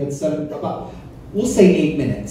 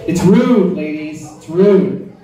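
ordinary delivery

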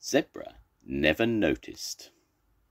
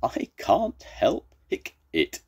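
hiccuping; 8 semitones higher than usual. Acted. Zebra helplessly answers Crocodile, hiccuping. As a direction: stretch the plea and hiccup before the end. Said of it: "Zebra just laughed".

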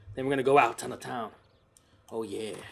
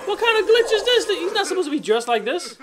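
high, laughing outburst; laughing disbelief; 14 semitones higher, 4 dB louder than usual. Real, not acted. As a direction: squeal the question through laughter. Cracking up at outfit glitch.

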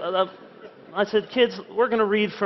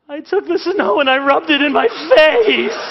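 put-on high, loud wailing little-boy confession; sobbing child; 10 semitones higher, 8 dB louder than usual. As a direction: wail it out like a guilty kid breaking down. Son confesses at family devotions.

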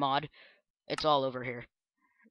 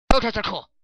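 excited catchphrase; 6 semitones higher, 7 dB louder than usual. Acted. Eleventh Doctor catchphrase.

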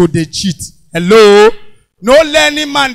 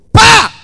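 slap sound; 11 semitones higher, 7 dB louder than usual. Retold or imitated.

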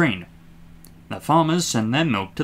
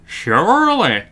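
slow, raised appeal; coaxing; 7 semitones higher, 6 dB louder, slower than usual. A narrator's reading. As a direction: reason with a stubborn engine. Driver reasoning with Daisy.

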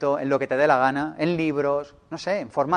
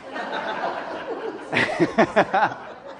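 breathy laugh; 4 semitones higher, 5 dB quieter than usual. Real, not acted. Audience reacts to lottery proposal.

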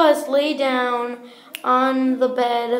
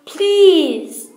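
loud, high, drawn-out plea; pleading; 6 semitones higher, 9 dB louder than usual. Acted. Santa begs the bears to keep his secret, pleading. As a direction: beg with one big falling word.

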